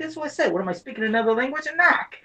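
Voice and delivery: exasperated Bostonian, high-pitched; Boston accent. Retold or imitated. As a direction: fire back in a high Boston accent, indignant. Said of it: "'cause of the damn accent".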